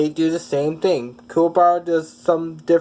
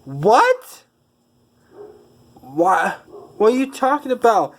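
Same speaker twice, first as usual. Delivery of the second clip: high, incredulous outburst; shocked disbelief; 7 semitones higher than usual. Acted. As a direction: react stunned, pitch shooting up. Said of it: "Yusuke is just shocked".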